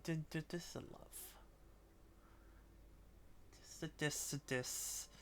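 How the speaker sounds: tender sing-song murmur. Real, not acted. Cooing over the cat.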